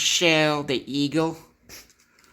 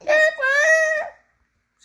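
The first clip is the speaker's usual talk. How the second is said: very high, slow squeak; high squeaky voice; 6 dB louder than usual. Pretend. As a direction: squeak the question slowly. Squeaky puppet question.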